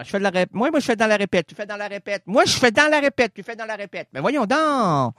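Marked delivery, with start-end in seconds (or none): 1.5-2.2 hushed reply; softer imagined voice. Acted. Alternating voices in dialogue. 2.2-3.3 pitched up, louder protest; outraged self-defense; indignant higher voice. Acted. Alternating voices in dialogue.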